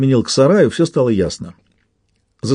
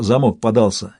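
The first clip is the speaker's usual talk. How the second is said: ordinary delivery, nothing marked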